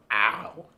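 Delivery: loud yelp of pain; pained scream. Real, not acted. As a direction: cry out as the plaster rips. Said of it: "stay tuned for a scream".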